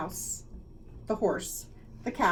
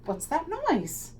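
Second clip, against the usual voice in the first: puzzled; 9 semitones higher, slower than usual. Acted. Librarian puzzled.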